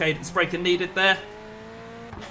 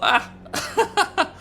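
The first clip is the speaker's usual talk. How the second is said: sudden high panicked yelp; startled yelp; 13 semitones higher than usual. Retold or imitated. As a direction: squeal in mock panic. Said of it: "I was like".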